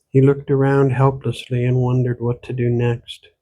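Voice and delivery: plain reading voice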